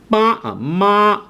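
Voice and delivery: ma slow and high like mom; ma drawn out at a high pitch; Cantonese ma. Shown as a demonstration. Teacher models ma for learners. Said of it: "as in mom".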